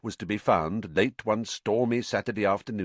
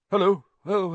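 higher, louder startled hello, rising; startled greeting; 11 semitones higher, 6 dB louder than usual. A narrator's reading. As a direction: caught off guard, jump at the greeting. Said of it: "starting and looking round".

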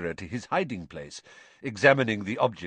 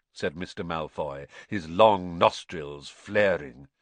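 usual speaking voice

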